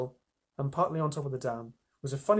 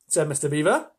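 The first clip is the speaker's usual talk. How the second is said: usual speaking voice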